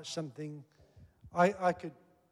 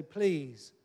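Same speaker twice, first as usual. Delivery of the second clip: ordinary talk, nothing marked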